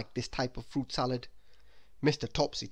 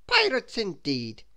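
slow, louder, amused officer; smiling; 5 semitones higher, 5 dB louder, slower than usual. A narrator's reading. The policeman sees the supposed pirates, smiling. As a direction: say it slowly through a smile. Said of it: "he said, smiling".

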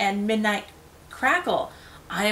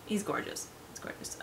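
soft whispered awe; hushed admiring whisper; 15 dB quieter, faster than usual. Real, not acted. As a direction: whisper it lovingly.

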